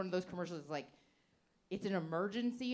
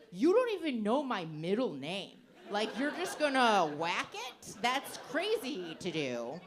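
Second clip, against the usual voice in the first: mock indignant; 2 semitones higher, 6 dB louder, slower than usual. Indignant retold reply.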